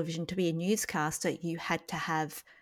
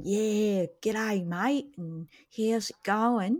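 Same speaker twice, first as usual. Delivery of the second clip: lightly broad Australian drawl, slower; a hint of broad Australian ocker; broad Australian accent; 2 semitones higher, much slower than usual. Shown as a demonstration. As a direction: ham up a broad Australian greeting. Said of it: "that real Australian".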